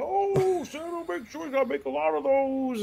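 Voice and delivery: mock customer. Imitating worker talking to Santa.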